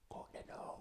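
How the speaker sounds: whispered urging from a small inner voice. Retold or imitated. Telling how his inner voice urges him, whispered. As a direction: breathe it like a tiny voice in your ear. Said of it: "there's a little voice, like a tweeter say".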